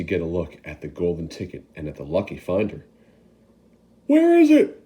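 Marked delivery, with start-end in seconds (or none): 4.1-4.8 much higher, louder call from the crowd; shouted. Acted. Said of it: "shouted somebody".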